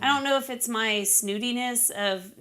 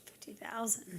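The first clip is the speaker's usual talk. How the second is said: breathless awed whisper; 14 dB quieter than usual. Real, not acted. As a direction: whisper it in disbelief. Stunned at the bourbon's price.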